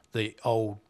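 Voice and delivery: normal talking voice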